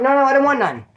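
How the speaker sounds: high-pitched mock pleading; imitated panicky refusal. Punched man running out, mock panicky. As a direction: mimic someone fleeing a fight.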